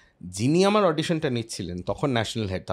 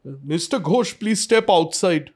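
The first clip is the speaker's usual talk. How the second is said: higher-pitched priest summoning a pupil; stern vice principal; 5 semitones higher, 4 dB louder than usual. Retold or imitated. Vice principal orders sleeping student out of class, stern. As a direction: imitate a vice principal calling someone out.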